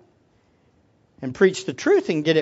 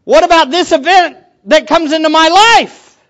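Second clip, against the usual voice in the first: loud, higher, pleading inner voice; acting out his own doubts; 6 semitones higher, 9 dB louder than usual. Pastor voicing his doubts to Jesus, loud and high. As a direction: argue with God out loud. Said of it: "I think like this".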